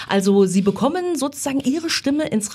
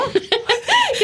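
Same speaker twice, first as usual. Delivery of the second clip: kurzes Lachen. Real, not acted. Laughs at her own joke.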